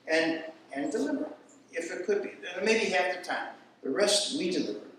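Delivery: normal talking voice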